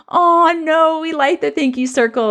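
imitating kids' mild dismay; mock disappointed sigh. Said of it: "there was this audible sigh of".

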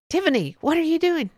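high, exasperated principal voice; shocked principal. Retold or imitated. Principal finds teacher painting her nails, high exasperation. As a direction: pitch the name up in disbelief.

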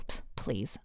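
low, soft scoff; dismissive retort. Retold or imitated. Woman retorting to her lover's guilt, low and dismissive. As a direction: brush it off under the breath. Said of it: "I retorted".

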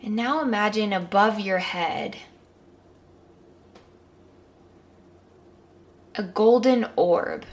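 calm guided meditation. Acted.

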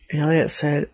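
ordinary delivery

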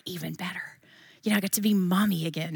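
hushed, delighted whisper; whispered glee. Retold or imitated. Mom glad her sick daughter stays home, whispered. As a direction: whisper it like a happy secret.

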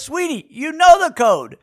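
imitated wife, high-pitched insistence; Karen. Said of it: "And she goes".

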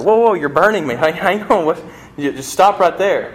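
hammed-up pulling away from the heat; mock alarm. A mild mock alarm as the ghost is burned by the angel. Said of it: "the guy's like".

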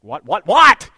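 loud, fed-up burst; exasperated dad. Retold or imitated. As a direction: snap back at the nagging kids. Dad answering kids, exasperated.

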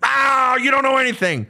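high, shouted mock tantrum of a rebellious kid; defiant teen outburst. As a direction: yell it like a teenager slamming back at a parent. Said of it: "it's like".

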